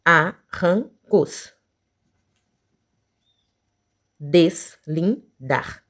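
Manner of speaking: each word very slow, broken into syllables; long gaps between syllables. Shown as a demonstration. Teacher seeking three-syllable words, each slowly separated. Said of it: "palavras com três sílabas".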